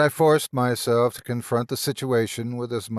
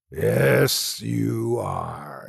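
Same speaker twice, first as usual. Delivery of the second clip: a rasping creature taunt; a hint of monster rasp; much slower than usual. A narrator's reading. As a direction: scrape the words out. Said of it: "it rasped".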